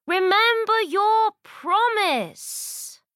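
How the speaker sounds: high, slow desperate yell; frog shouting. A narrator's reading. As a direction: cry out as he is thrown away. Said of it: "The frog shouts".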